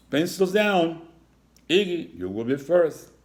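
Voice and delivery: loud high bossy command; loud honking order. Acted. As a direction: bark it out like a strict principal. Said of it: "Principal Horn honked".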